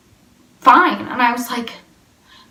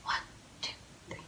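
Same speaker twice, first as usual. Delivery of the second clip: whispered, soft counting of the group; 25 dB quieter, much faster than usual. Retold or imitated. Suspicious lady counting the girls, whispered. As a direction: count them off under your breath. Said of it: "and she goes".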